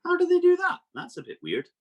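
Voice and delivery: squeaky high surprised What; mock the kids' shocked question; high mock amazement then back down; imitating a puzzled kid. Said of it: "Now you're thinking".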